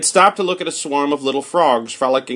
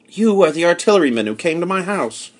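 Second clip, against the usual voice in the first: higher, eager realization; excited recognition; 6 semitones higher than usual. Acted. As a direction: say it as it dawns on you. Narrator recognizes soldier.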